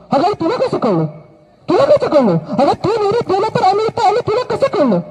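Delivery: urgent, astonished questioning; stunned disbelief. Acted. King to the mother, shocked she already knew.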